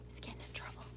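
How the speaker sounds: a hushed whisper aside; stage whisper. Acted. Grandma whispering an aside to the child.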